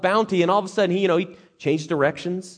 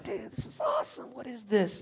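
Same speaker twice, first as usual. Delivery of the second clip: breathless hushed awe; whispered delight; 6 semitones higher, 11 dB quieter than usual. Retold or imitated. Achan alone in his tent at night, whispering in awe. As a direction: whisper it so no one wakes. Achan secretly admiring stolen robe.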